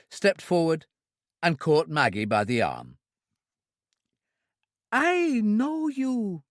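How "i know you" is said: put-on high, slow childlike voice, delighted; a simple girl thrilled to see a friend; high, drawn-out childlike delight; delighted Trudy. A narrator's reading. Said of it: "Trudy said delightedly".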